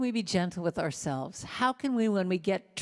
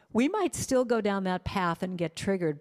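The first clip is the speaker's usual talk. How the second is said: ordinary delivery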